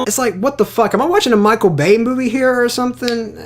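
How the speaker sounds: high-pitched, exasperated disbelief; incredulous outburst. Acted. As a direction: protest as if you cannot believe it. Mock outrage at the caricature.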